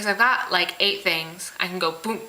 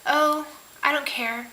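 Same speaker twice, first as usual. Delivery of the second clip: indifferent; 5 semitones higher, slower than usual. Acted. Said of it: "if you're just like".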